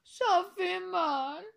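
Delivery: high, slow pained wail; long high whine of pain; pained moan. A narrator's reading. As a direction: drag out the hurt. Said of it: "gémit-il".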